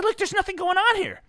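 high, exasperated retelling; indignant retort. Retold or imitated. Retold argument at barber.

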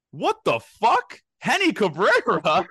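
high, slow, drawn-out shock at a celebrity sighting; can't believe who's standing there; stunned disbelief. Retold or imitated. Friend spotting a star player in the bathroom, stunned disbelief.

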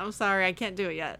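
usual speaking voice

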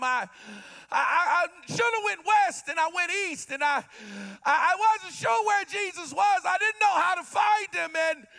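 high, loud, stammering despair; a broken father crying out too late; desperate father pleading, near a shout; distraught father. Acted. Jairus breaks down, acted.

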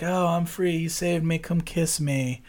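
soft, slow come-hither coo; mock damsel coo. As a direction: breathe it like a fake damsel. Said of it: "he's like".

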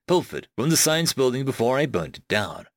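usual speaking voice

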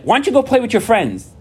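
high put-on parent voice brushing the kid off; high mock parent shooing the kid off; dismissive parent. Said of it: "And then it's like".

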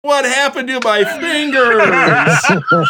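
very slow, high, laughing mock kid whine; kids quoting. Uncle imitates his nieces and nephews quoting a gruesome line, slow high laughing kid whine. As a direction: drag it out like a kid reciting a grim line. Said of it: "nieces and nephews would constantly just say".

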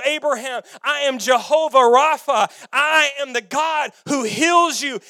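proclaiming God voice. Acted. God declaring himself to Abraham, loud and rising. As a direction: build it up like thunder.

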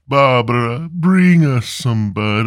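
ordinary delivery

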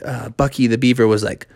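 usual speaking voice

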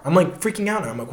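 usual speaking voice

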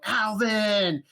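high, drawn-out mock scream; Dave impression. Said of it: "he started screaming".

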